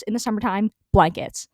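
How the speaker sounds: normal talking voice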